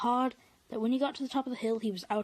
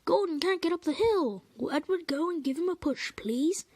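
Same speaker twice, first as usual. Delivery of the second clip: calling out; 7 semitones higher, 3 dB louder than usual. Acted. Porter calling out.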